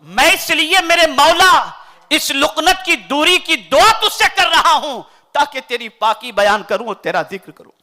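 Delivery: ordinary delivery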